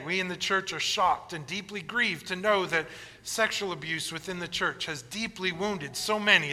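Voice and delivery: ordinary talk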